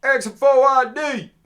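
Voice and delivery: booming, high-pitched yell from afar; shouting big dude. Retold or imitated. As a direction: bellow it like a big street guy. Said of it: "he's yelling at me".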